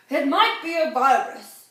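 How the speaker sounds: loud, weighty expert voice; authoritative announcement. A narrator's reading. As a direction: pronounce it like the final word of an expert. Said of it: "he announced with authority".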